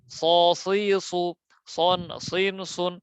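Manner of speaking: স, সি, সু, সন, সিন, সুন thick and rounded; heavy syllables. Shown as a demonstration. Teacher showing the difference, heavy letters. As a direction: keep স, সি, সু, সন, সিন, সুন full-mouthed. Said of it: "ওটার সাথে এটাতে অনেক পার্থক্য".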